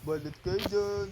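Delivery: slow, drawn-out vendor call; balut vendor cry. Pretend. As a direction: sing it out like a street hawker. Street vendor calling customers.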